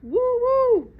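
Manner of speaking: high loud bark; dog bark. Acted. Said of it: "who barked".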